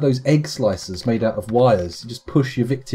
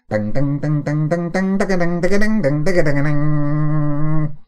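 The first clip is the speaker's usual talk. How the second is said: quickening run of raised dings, last one held; piercing plucked-string run; 4 semitones higher, 5 dB louder than usual. Shown as a demonstration. Streamer trying out a vibrating harp arrangement, piercing run of dings. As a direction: imitate a harp run, rising then ringing out. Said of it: "vibrating and piercing".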